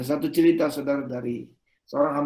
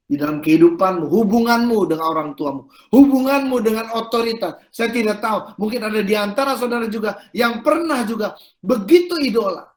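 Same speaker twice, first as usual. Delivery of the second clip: raised, loud sermon appeal; forceful preaching; 6 semitones higher, 6 dB louder than usual. Real, not acted. Preacher asks congregation about their relationships, loud forceful preaching. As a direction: press the question on the congregation, pitched up.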